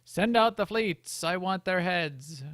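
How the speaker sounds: slow, loud, high royal command; commanding shout. Retold or imitated. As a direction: order the fleets out. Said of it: "get up and go".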